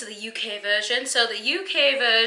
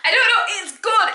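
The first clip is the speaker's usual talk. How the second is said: laughing; 7 semitones higher, 7 dB louder, slower than usual. Real, not acted. Host laughing at her obvious bias toward viewers, laughing. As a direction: laugh through it, high and louder.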